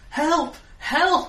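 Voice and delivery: high, loud fake cries for help; screaming for help. Fortune teller screams far behind.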